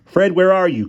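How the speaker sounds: high, loud call into the dark. Acted. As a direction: call out for Fred, worried. Calling for missing friend.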